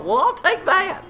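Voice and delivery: high, put-on customer voice; huffy mock passenger, pitched high; indignant passenger. Passenger choosing free options down the hall, high and huffy.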